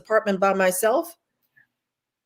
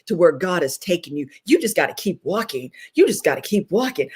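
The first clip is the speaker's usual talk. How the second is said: ordinary delivery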